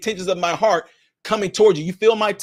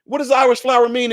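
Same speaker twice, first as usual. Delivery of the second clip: puzzled asking around; 7 semitones higher than usual. Retold or imitated. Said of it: "you're gonna start asking people".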